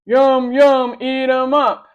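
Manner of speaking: loud, high monster chant; monster shout. A narrator's reading. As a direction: bellow it like hungry monsters. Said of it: "they shouted".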